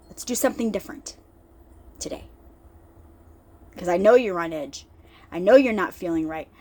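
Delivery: gentle and kind. Retold or imitated. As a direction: reassure her quietly, no rush. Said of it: "very gently, very softly, very nicely, very kindly said".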